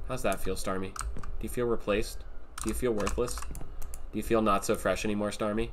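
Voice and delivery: soft, low mock consoling; mock pity. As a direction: quietly needle them with false sympathy. Mock-consoling a benched Pokémon.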